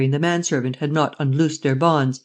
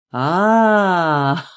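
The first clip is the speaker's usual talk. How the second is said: laughing cry. A narrator's reading. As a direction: burst out laughing in triumph. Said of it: "cried Lupin, shouting with laughter".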